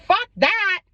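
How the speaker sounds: high, punchy retold outburst; indignant dad. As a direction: imitate an outraged dad refusing flat out. Dad's outraged reaction retold.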